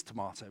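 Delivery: TOMATO with a British "ah" in the middle; British pronunciation. Shown as a demonstration. Briton teasing Americans over language, British-pronounced "tomato".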